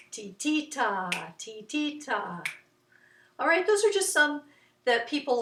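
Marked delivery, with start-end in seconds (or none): 0.1-2.5 slow 'titita', middle syllable accented; measured ti-TI-ta chant; push on second ti. Shown as a demonstration. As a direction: lean on the second 'ti', hold 'ta'. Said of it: "where you would put the emphasis".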